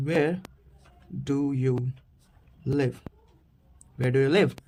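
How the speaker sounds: normal talking voice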